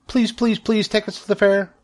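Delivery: high, pleading little gosling voice; begging gosling. A narrator's reading. Gosling begging its mother, high and pleading. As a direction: beg like a tiny gosling. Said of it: "begged a gosling".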